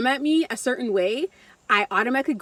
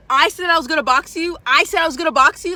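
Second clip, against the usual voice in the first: louder, higher, in her face; aggressive threat; 7 semitones higher, 6 dB louder than usual. Retold or imitated. In the coworker's face, threatening to fight her. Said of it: "I'm getting in her face".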